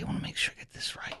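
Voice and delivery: whispered aside to himself. Real, not acted. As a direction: whisper it off-mic, quickly. Checking notes on air, aside.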